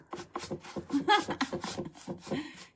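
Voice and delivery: high burst of giggles; laughing at herself. Real, not acted. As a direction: crack yourself up laughing. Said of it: "Cracking myself up".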